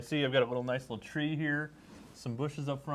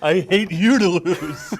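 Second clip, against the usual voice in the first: laughing; 6 semitones higher, 13 dB louder than usual. Real, not acted. Laughing mock echo of cohost.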